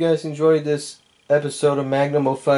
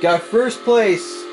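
relieved excitement; 7 semitones higher, much slower than usual. Real, not acted. Finally wins first place.

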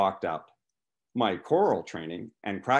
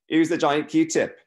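put-on louder, higher, slow British-accented question; 7 semitones higher, 7 dB louder than usual. A narrator's reading. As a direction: ask it loudly in a drawn-out British accent. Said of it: "said with a British accent".

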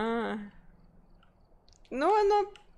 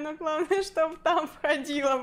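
normal talking voice

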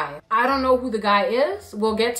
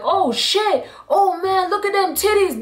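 high-pitched imitation of rowdy guys; mock excited hooting; 8 semitones higher than usual. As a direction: mimic guys going wild at a party. Imitates guys going wild.

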